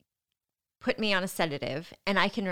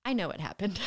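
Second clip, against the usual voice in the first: ordinary talk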